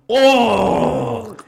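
high, loud startled cry; shocked shout acting out reaction to a gory scene; shocked exclamation. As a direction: blurt it like a brutal scene just hit. Retelling noises made while watching.

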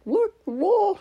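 high squeaky mock dog voice; voicing the dog. Voicing her dog Panic as he's startled, high and squeaky. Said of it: "my dog, where he's like".

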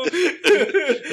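high loud laughing fit. Real, not acted. Friend bursts out laughing.

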